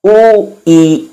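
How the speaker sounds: ओ and ई with a break between; ओ, stop, ई; o then ee broken. Shown as a demonstration. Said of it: "रुकना पड़ा".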